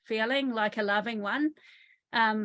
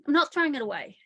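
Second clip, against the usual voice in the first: a hint of a defiant teen, higher and louder; stroppy teenager; 4 semitones higher, 5 dB louder, faster than usual. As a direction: refuse it like a stubborn teenager. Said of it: "being a teenager".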